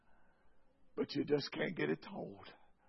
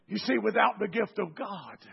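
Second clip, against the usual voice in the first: normal talking voice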